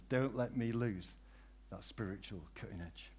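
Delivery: soft, low prayer murmured to God; quiet prayer. Real, not acted. Preacher turning to pray softly to God. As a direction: drop into a hushed personal prayer.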